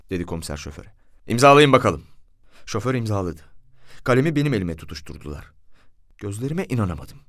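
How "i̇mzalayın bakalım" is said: loud, brisk official command; commissioner's order. A narrator's reading. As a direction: order him to sign, raised voice. Commissioner orders driver to sign.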